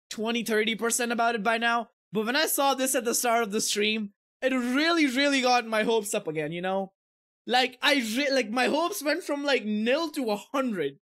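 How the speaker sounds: usual speaking voice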